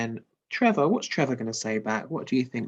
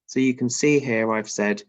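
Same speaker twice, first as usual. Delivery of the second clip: ordinary delivery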